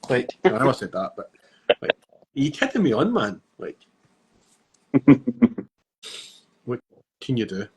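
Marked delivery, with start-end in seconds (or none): none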